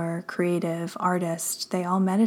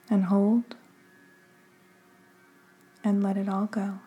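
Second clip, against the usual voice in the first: unhurried meditation guide, long pauses between phrases; measured, calming delivery with room to breathe; guided meditation. Acted. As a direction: guide the listener gently into stillness, phrase by phrase.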